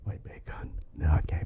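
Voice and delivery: whisper. Real, not acted. Quiet aside before recording.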